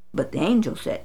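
plain reading voice

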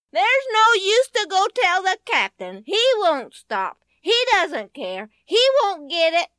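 put-on high, loud child hollering; boy hollering at Joni as she runs to the captain; Benny hollering. A narrator's reading. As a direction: holler after her like a cross little boy.

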